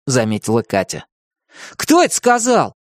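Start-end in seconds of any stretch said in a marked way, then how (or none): 1.8-2.7 heated. A narrator's reading. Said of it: "запальчиво спросил Тим".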